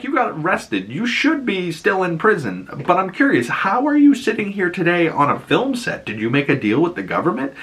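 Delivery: high-pitched retold grilling; mock interrogation. Acted interrogation of Trevor.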